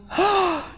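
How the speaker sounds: a high, sharp gasp of delight; excited gasp. Acted. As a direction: gasp like you just heard big news. Friend reacting to secret news.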